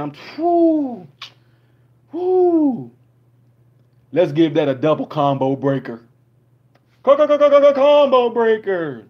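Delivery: mock ecstatic reactor; hammed-up overwhelmed whoop. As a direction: shout it like a fighting-game announcer. Parody reactor overwhelmed, combo breaker.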